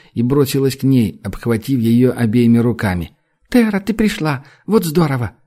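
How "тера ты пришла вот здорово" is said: put-on excited girl. Girl greets friend joyfully.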